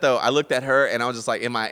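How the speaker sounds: ordinary delivery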